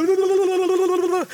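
high babbling blu blu blu; mock babbling. Preacher mocks a talkative brother, high babble. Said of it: "hay unos hermanos que".

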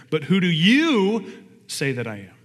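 slow question that hammers the word "you"; stress on YOU. Shown as a demonstration. Preacher showing the congregation the emphatic pronoun, stress on "you". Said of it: "The emphasis is there".

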